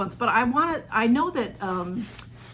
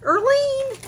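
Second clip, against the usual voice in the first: high, loud delighted call of a name; crying out the friend's name in joy; excited cry; 7 dB louder than usual. Real, not acted. Overwhelmed by a gift, calling her friend's name high and loud.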